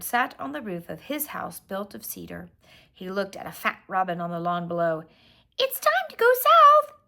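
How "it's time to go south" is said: high far-carrying bluebird call. Acted. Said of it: "he called".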